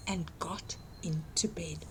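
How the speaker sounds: hushed, secret narration; whisper. A narrator's reading. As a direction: whisper so the wolf won't hear.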